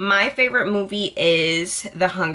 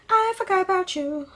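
mock high; 11 semitones higher than usual. Repeated dismissal to exes.

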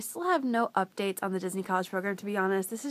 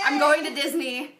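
louder, laughing with joy; real giddy cheer; excited laughing; 4 semitones higher, 7 dB louder than usual. Real, not acted. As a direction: announce it bursting with happiness. Celebrating the acceptance.

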